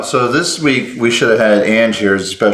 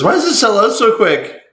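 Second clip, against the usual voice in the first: slow, high-pitched mock customer question; imitated customer complaint; 10 semitones higher than usual. Shop host quoting everybody who comes in, high mock complaint. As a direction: imitate a puzzled shopper, pitched well up. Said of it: "everybody comes in and says".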